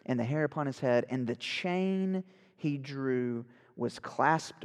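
slow storybook narration; dramatic reading. Acted. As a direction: read it slowly, like a classic novel. Reads Dickens aloud.

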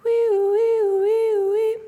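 high wailing siren noise; imitated police siren. Imitating the police siren behind her.

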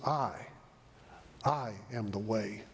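solemn, weighty stress on "I"; emphatic I. Retold or imitated. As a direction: set "I" apart before "I am the way". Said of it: "Jesus uses that emphatic".

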